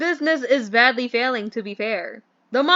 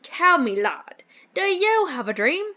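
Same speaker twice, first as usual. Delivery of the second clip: posh British gentleman. Acted. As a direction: play the fussy English employer in a mild posh accent. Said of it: "I hate this accent".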